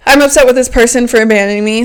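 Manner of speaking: rattled off fast as a recap; quick restatement. Shown as a demonstration. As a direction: repeat the step briskly.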